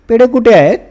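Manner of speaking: put-on child home from school, calling for sweets; eager shout. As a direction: burst in demanding the sweets. Child bursts in asking for sweets.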